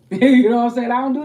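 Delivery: laughing loudly through the question; loud laugh, then the words. Real, not acted. Laughing after her story.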